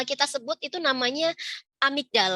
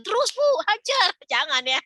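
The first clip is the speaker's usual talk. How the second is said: high, pushed cheer; mock egging on; 8 semitones higher, 4 dB louder than usual. Onlooker at the neighbours' quarrel, playful egging on. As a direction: jokingly urge on a fight.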